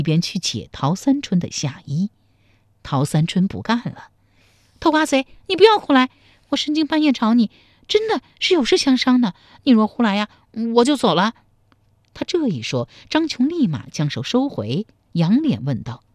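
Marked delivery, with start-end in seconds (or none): 4.8-11.3 high, loud woman's protest; flustered, firm objection; indignant warning. Acted. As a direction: warn him off in a high voice. Woman objects to his advances.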